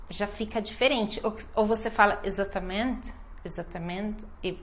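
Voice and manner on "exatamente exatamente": "exatamente" softly, all syllables even, ending swallowed; unstressed "exatament" with the last vowel dropped; no emphasis. Retold or imitated. Teacher imitating students who skip emphasis, unstressed soft "exatamente". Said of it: "não dá ênfase".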